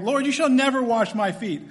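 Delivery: high, loud adamant refusal as Peter; hammed-up Peter declaring it with force; adamant declaration. Peter adamantly refusing his master, loud and high. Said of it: "adamantly declaring".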